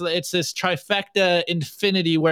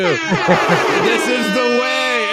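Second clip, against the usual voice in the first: higher, louder, very slow drawn-out line; mock solemn catchphrase; 6 semitones higher, 5 dB louder, much slower than usual. Host hamming the meme catchphrase for his co-host. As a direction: stretch it like a grand ritual chant. Hammed-up catchphrase after laugh.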